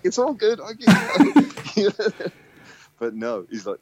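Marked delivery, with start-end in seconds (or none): 0.0-2.3 high, louder fake-cheery voice; falsetto appeasing. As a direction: squeak it out nervously to smooth things over. Self quote, very high.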